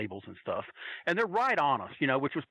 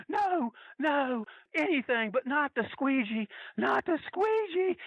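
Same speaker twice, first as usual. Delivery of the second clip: high, hammed-up plea; imitated pleading, pitched way up; mock begging; 11 semitones higher, slower than usual. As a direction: beg for mercy like a cornered wrestler. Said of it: "He's begging down to his knees".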